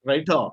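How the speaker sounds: "writer" ending in an open "ah"; silent final R. Shown as a demonstration. Teacher correcting for students, "writer" without final R. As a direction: drop the last R of "writer". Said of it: "R should be removed from your pronunciation".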